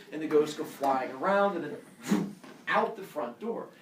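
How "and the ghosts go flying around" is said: usual speaking voice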